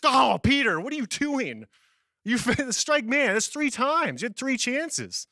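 high, pained, exasperated cry of disbelief; dismayed angels. Pretend. Angels in heaven watching Peter, dismayed high groan.

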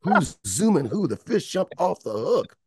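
slow teasing line given to a songwriter. Retold or imitated. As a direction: savor each word like a teasing diva. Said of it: "That's how she talked".

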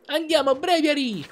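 high, loud shout of the name; battle cry. Acted. Trainer shouting to send his Pokémon into battle, loud battle cry. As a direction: call the Pokémon out with full force.

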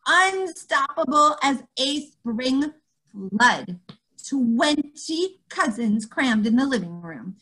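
usual speaking voice

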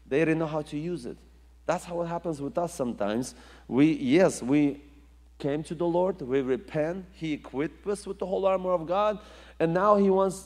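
normal talking voice

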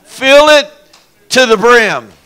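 loud and high; preacher's shouted fill it; shouted command. Acted. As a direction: shout it to the back row. Shouted sermon climax.

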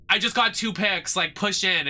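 imitated exasperated gamer; mimicking mad teammate. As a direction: whine like a tilted teammate, lightly exaggerated. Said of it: "some guy was so mad at me".

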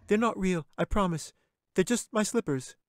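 put-on high young girl's voice; little girl. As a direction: reassure the bear like a frightened little girl. Katy pleads with the bear.

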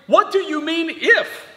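high-pitched, challenging "if?"; Jesus's incredulous question. Acted. Preacher voicing Jesus answering the doubting father, incredulous. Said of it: "Jesus says to him".

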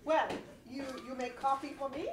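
put-on Russian accent. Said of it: "It was Russian".

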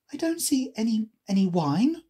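a hint of a girl character; Alice. A narrator's reading. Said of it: "she remarked".

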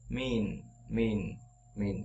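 "mean" kéo dài âm i; đọc mẫu "mean" với âm i dài. Shown as a demonstration. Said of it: "mean là y dài". ("mean" đọc với i dài)

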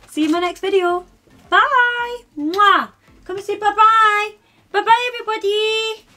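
high doggy voice for waving bye to viewers; talking to the dog. Real, not acted. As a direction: coo goodbye like talking to a puppy. Baby talk to pet dog.

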